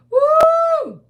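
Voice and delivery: high loud whooping cry; delighted whoop. Real, not acted. Auntie whooping at her live viewers in delight.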